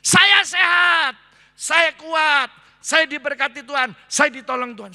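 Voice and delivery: shouted, high and slow at first, each saya hammered; bold declaration. Shown as a demonstration. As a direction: proclaim it like a battle cry. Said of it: "Saudara harus memperkatakan". (deklarasi lantang, diteriakkan dengan penuh iman)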